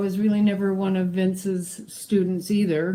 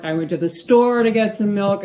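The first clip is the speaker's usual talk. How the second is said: "store" raised and louder than the other words; stress on STORE; 3 semitones higher, 6 dB louder than usual. Shown as a demonstration. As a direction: lean hard on "store". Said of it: "how emphasis on certain words changes".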